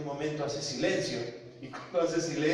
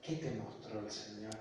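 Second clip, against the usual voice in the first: low, soft ironic question; retold mother's quiet irony; 4 semitones lower, 12 dB quieter than usual. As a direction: ask it dryly under the breath. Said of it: "como irónicamente".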